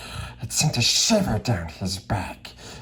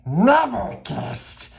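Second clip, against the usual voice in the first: higher, slow creature voice; Gollum sneering; 9 semitones higher, slower than usual. Acted. Gollum answers Bilbo in a slow, sly creature voice. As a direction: drag the words out slyly. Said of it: "said Gollum".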